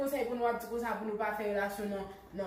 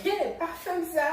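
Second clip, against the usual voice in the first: protesting shout; 6 semitones higher, 4 dB louder than usual. Acted. Girlfriend refusing the boyfriend's advances.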